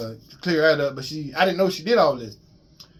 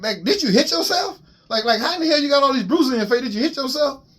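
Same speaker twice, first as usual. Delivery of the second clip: mocking niece; 8 semitones higher than usual. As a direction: needle her, teasing and disbelieving. Niece mocking the woman's bruises.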